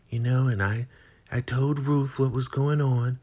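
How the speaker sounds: high, loud, unbothered put-on voice; cool Joan. Joan calming scared River, high and dismissive. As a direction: brush off his panic. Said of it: "She's like".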